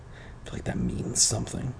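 breathy, unvoiced whisper; whispered aside. Real, not acted. A reader's aside to the listener, whispered. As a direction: drop to a hushed whisper. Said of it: "unvoiced aside".

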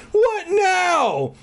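very high, hammed-up wail; over-the-top high-pitched despair; exaggerated dread. A narrator's reading. Repeats the thought far more hammed-up.

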